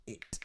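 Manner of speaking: "it" ending in a sharply released T; fully pronounced T. Shown as a demonstration. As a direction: sound the T of "it" on purpose. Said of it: "When that's pronounced".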